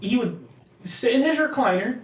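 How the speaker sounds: usual speaking voice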